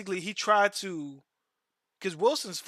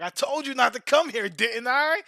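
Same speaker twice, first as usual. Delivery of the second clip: put-on anime kid; 6 semitones higher, 5 dB louder than usual. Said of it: "was like".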